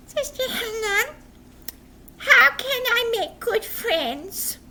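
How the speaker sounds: put-on high, slow puppet boy; puppet question. Puppet boy asks teacher for advice, high and slow. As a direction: ask it slowly and earnestly.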